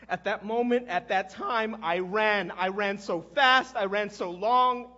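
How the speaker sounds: loud, insistent, drawn-out repeated phrases; anguished confession. Acted. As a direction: cry out the confession, leaning on each repeat. Anguished confession of running away.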